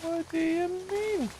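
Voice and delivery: joking Irish brogue, slowed right down; mock Irish accent. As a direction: ham up an Irish accent while insisting you don't have one. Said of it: "he has a very strong Irish ac".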